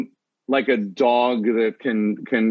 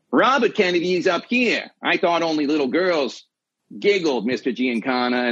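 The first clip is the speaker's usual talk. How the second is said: Robert Kennedy; 3 semitones higher than usual. Shown as a demonstration. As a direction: do a Kennedy impression, pitched up at the start. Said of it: "between Robert Kennedy and John F. Kennedy is just timber".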